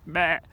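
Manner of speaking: loud, low sheep "meh"; gruff-tempered sheep imitation; sheep bleat, too angry. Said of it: "No, that's not angry".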